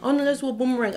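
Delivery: lightly voiced eager friend; mock eager friend. Friend's voice begging for boomerang.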